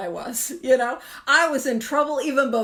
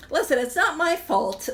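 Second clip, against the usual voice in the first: usual speaking voice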